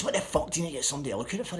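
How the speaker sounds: high, fast, indignant acted rant; raging. Insulted seller questions his buyer about the check, raging. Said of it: "he was fucking raging".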